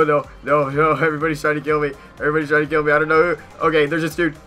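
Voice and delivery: ordinary delivery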